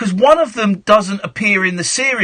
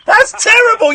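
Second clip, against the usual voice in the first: laughing outburst; 12 semitones higher, 5 dB louder than usual. Real, not acted. Host mocks the caller's fake accent.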